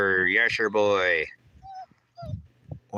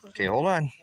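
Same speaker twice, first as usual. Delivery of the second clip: high, lilting pet talk, climbing higher; coaxing pet voice; 12 semitones higher than usual. Real, not acted. Owner holding off the eager dog, high coaxing pet voice. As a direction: sweet-talk the dog into waiting.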